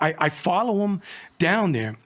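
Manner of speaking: ordinary delivery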